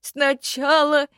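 slow, high, hollow ghost voice; skeleton voice. A narrator's reading. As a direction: drag each word like an old corpse. Skeleton tells story.

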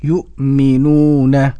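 "يؤمنون" loud and very slow, long uu; careful model repetition. Shown as a demonstration. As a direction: repeat "يؤمنون" firmly, stretched out. Host repeats the modelled word. ("يؤمنون" بصوت قوي ممدود)

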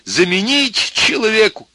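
high, slow rhetorical question; imploring appeal. A narrator's reading. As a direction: plead it in a lofty, stretched voice. Professor continues his dictated appeal.

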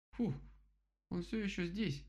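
высокий голос кролика, выдох облегчения; выпали это высоко, с облегчением; выпаливает с облегчением. A narrator's reading. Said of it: "выпалил кролик". (облегчённо, голосом кролика)

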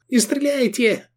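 very high frightened squeal; high-pitched plea. A narrator's reading. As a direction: squeal it in terror, no added volume. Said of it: "взвизгнул Исмат".

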